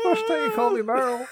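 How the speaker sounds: very high, mock-crying delight; hammed-up happy tears; teary gush. Meryl overjoyed Roberto used her name, high mock-teary voice. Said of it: "And she's like".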